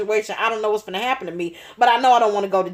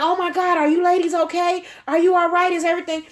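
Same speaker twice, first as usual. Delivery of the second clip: worried man; 7 semitones higher, faster than usual. Retold or imitated.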